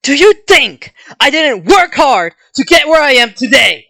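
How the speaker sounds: loud, high-pitched furious retort; angry shout. Acted. Kendo answering a taunt about spunk, angry shout. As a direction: yell it, stung by the taunt.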